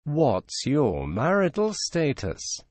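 normal talking voice

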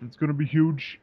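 lightly voiced Trump catchphrase. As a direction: drop the catchphrase like a hint of Trump. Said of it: "you punctuate every sentence with".

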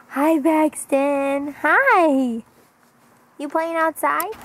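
drawn-out, singsong hello. Real, not acted. Greeting her baby.

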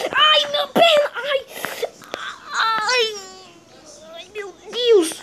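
high, loud yelp of pain; hammed-up pain yell; pain cry. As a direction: cry out as if the leg just snapped. Dinosaur hurts its leg falling.

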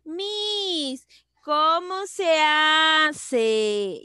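drawn-out, high pupil whine for help; imitating pupils. Teacher imitating pupils asking how it's done, drawn-out pupil voice. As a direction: stretch it out like a puzzled schoolchild calling. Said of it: "ustedes me dicen".

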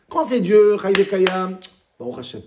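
ordinary talk

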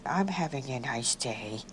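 low, soft, drawn-out sad voice. Shown as a demonstration. Twin tries a sad voice for the examiner, sad. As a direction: say it sadly, pitch sunk and volume down. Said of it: "in a sad tone of voice".

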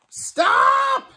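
high shrill scream to halt everyone; tiny ant screaming stop. A narrator's reading. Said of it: "screamed the smallest ant".

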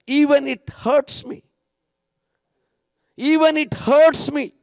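high, insistent cry of surrender; fervent prayer. Acted. Preacher praying for God's will despite hurt, fervently.

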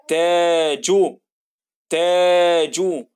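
تاجو very slow, the first vowel held long; stretched تاجو. Shown as a demonstration. Teacher modelling a long vowel in تاجو, very slow. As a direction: hold the تا in تاجو slowly. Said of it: "تا زي كلمة إيه".

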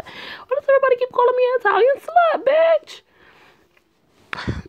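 wife shrieking back at the ex about the insult; outraged high-pitched retort. Acted. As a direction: fire it back high and fast, fed up. Wife snaps at the ex.

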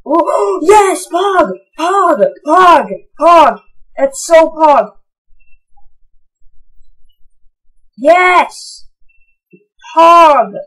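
high, loud cries of Pog; yelling with real joy at the loot; thrilled shouting. Real, not acted. Thrilled by rare trade drop.

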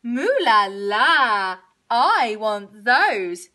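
slow, loud mooed admiration; drawn-out covetous moo; swooning cow. A narrator's reading. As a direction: stretch it out like a besotted cow. Cow covets shoes in window.

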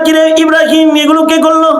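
high demanding father's voice. Retold or imitated. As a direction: angrily ask who did it. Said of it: "বাপে কয়". (ছেলেকে জেরা করা বাবার উঁচু গলা)

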